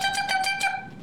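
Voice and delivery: high chirpy birdsong calls; bright little bird chirps; bird song. A narrator's reading. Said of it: "The little birds sing back".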